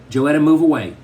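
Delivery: loud, stern mother's command; strange, menacing voice. A narrator's reading. Mother orders daughter out of the way, loud and menacing. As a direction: order it loudly with cold authority. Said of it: "Mama's voice still sounded tra-, strange".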